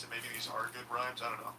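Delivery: ordinary talk, nothing marked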